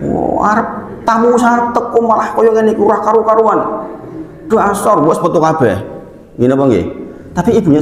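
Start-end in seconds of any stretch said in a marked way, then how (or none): 0.1-5.8 high exasperated scolding; mock scolding mother. Mother scolding child who spilled the food, mock angry. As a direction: snap at the naughty kid. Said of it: "Biasanya kayak gitu kan".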